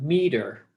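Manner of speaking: "meter" falling away after a stressed "me"; stress on first syllable. Shown as a demonstration. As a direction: hit "me-", let "-ter" drop in "meter". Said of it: "goes down at the end of the word".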